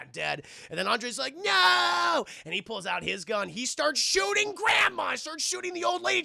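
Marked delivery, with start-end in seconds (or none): 1.4-2.2 high, loud cry of no; anguished shout. Retold or imitated. Andre seeing his wife shot, loud cry. 3.5-6.2 high, raised indignant voice; outraged retelling. Real, not acted. Telling chat about the shooting, high and outraged.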